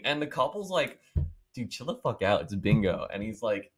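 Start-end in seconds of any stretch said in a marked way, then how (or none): 1.5-3.0 much softer, bored brush-off; mock couple waving him off quietly; dismissive. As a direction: mutter it like it's no big deal. Couple dismissing the angry man.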